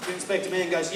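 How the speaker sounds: usual speaking voice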